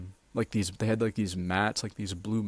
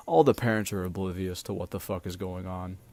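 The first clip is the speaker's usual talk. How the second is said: ordinary delivery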